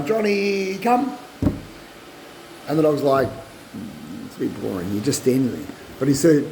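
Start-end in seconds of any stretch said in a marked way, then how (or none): 0.0-1.2 slow, high call of the dog's name; a drawn-out high recall. Shown as a demonstration. Calling the dog while standing. 3.3-5.7 soft, low and uninterested dog; a dog that can't be bothered; quiet, sulky dog voice; bored dog. Acted.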